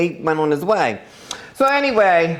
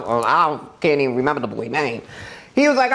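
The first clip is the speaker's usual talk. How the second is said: ordinary talk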